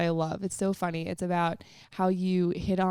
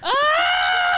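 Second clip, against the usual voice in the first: high, loud shocked shriek; 6 dB louder than usual. Real, not acted. Host hearing he texted other girls, shocked shriek. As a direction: shriek in disbelief.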